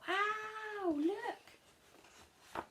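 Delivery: wow, look, in wonder; amazed. Acted. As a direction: gasp it out in amazement. Amazed at the picture.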